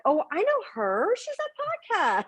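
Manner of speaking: mock a stranger spotting her online; gossiping stranger voice. Woman imagines strangers recognizing her online, in a put-on gossipy voice. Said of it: "they'd be like".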